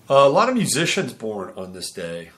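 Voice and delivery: ordinary talk, nothing marked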